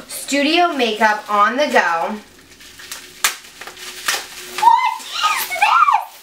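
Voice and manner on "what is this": real squeal of surprise; excited squeal. Real, not acted. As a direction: shriek with delight at the find. Spots the palette.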